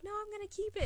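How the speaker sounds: put-on high, pleading kid voice; whiny kid. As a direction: whine it like a stubborn child. Son pleading to keep pet.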